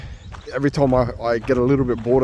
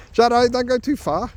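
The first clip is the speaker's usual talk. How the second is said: calling out; 11 semitones higher than usual. Real, not acted. Calling dog back.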